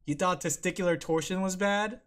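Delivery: ordinary talk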